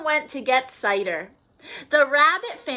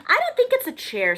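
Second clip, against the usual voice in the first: Field Mouse; 11 semitones higher, faster than usual. Acted. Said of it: "said Field Mouse".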